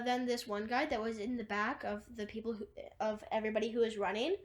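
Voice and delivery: normal talking voice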